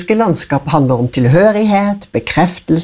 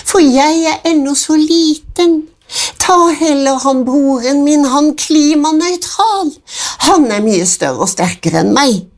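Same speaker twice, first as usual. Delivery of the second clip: the small goat begs the troll to spare him; pleading little goat; 10 semitones higher than usual. A narrator's reading. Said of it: "sa Klimasmart".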